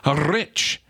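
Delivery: rolled-R Scottish burr; exaggerated mock-Scots delivery; Scottish accent. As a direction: try a hammy Scottish accent. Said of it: "say that in a Scottish accent".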